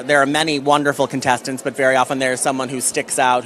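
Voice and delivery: ordinary talk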